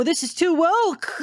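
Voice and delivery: high, slow mocking voice; mock anti-woke whine. Mocking the anti-woke straw argument, slow parody. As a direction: parody the complainer, drawn out. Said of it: "pseudo ... argument".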